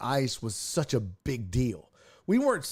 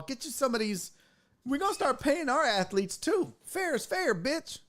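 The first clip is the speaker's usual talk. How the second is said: much higher, mocking taunt; cocky America voice; 9 semitones higher than usual. America taunting other countries with its Dream Team, much higher and mocking. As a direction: gloat like a smug bully. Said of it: "America started saying".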